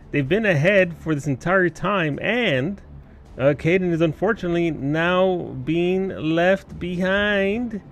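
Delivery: usual speaking voice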